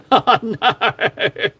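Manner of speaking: "oh no" through laughter; embarrassed giggle; laughing. Real, not acted. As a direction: laugh out the words at yourself. Laughing at own blunder.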